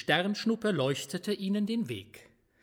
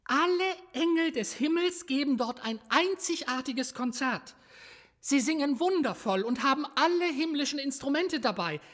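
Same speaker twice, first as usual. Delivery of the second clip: put-on higher, earnest child angel; little angel; 7 semitones higher than usual. A narrator's reading. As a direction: explain it like a small angel. The little angel Daniel explains.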